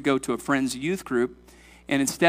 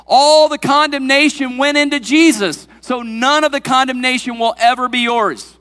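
raised, ringing sermon climax; full-force preaching; 6 semitones higher, 6 dB louder than usual. Real, not acted. Preacher proclaiming the glory of no condemnation, full force. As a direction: build in force to a high, loud proclamation.

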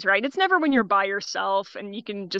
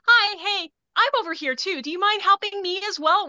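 much higher, bright, slightly raised polite call; friendly but meant to shame them into helping; chirpy "I'm over here too" voice; customer service voice; 9 semitones higher than usual. Shown as a demonstration. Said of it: "that tone of like".

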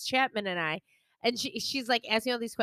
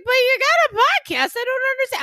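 high, loud mock-bewildered friend; baffled protest; 12 semitones higher, 8 dB louder than usual. Friend questions podcaster at the bar, loudly baffled.

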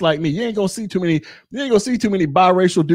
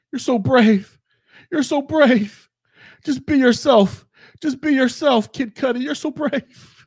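mock gushing praise; 6 semitones higher than usual. Mocking fans praising [name].

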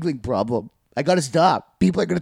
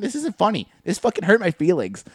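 higher, wounded protest; mock hurt; 6 semitones higher than usual. Upset storyteller to laughing listener, mock indignant. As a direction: scold the laugher, lightly hammed-up.

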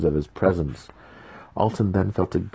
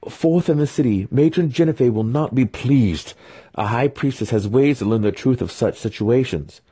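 indignant; 5 semitones higher, 4 dB louder than usual. A narrator's reading. Continues indignant threat.

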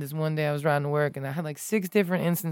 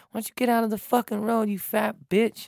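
retold angry scream; 6 semitones higher than usual. Driver screams at cyclist on the road, mock angry yell. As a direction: imitate an angry driver's scream, held back. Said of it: "he started screaming at me".

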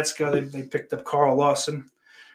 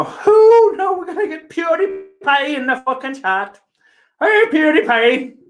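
high, loud mock-excited calling out; excited squeal; 13 semitones higher, 7 dB louder than usual. Streamer hails a celebrity supposedly in chat, high excited squeal. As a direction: squeal it, stretching the last greeting.

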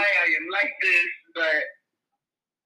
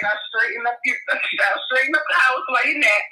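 ordinary talk, nothing marked

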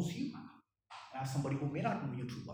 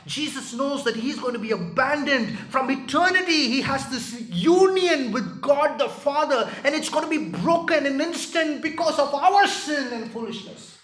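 much higher and louder, near shouting; impassioned preaching; 9 semitones higher, 14 dB louder than usual. Real, not acted. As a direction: cry it out from the pulpit. Peak of preaching on abandonment.

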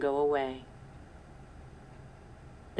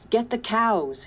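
higher, loud urgent command; shouted order; 6 semitones higher, 4 dB louder than usual. A narrator's reading. Papa shouting to Sarah during the fire, urgent. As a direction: bark it over the fire. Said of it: "he shouted to Sarah".

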